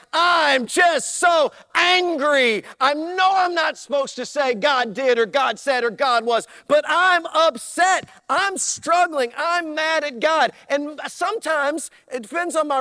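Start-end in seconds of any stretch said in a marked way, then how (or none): 0.1-10.5 upset congregant. Retold or imitated. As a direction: play the frustrated believer, shouting. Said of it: "and they're like".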